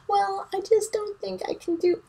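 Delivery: high, whiny mock self-pity; high whiny imitation of oneself; whiny self-doubt. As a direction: whimper it like a doubting Aquarius. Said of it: "I'm like".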